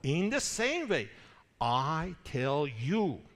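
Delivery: slow, weighty "I tell you" with each word leaned on; slow, emphatic declaration. Retold or imitated. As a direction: press the words home slowly, like a finger pointed at them. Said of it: "emphatic, uh, shows the emphasis".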